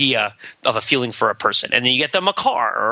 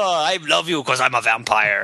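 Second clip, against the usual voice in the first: Dracula. Retold or imitated. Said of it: "Now I'm a Dracula".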